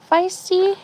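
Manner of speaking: sharp high call of the cat's name; startled call to the cat. Real, not acted. Calling out to her cat.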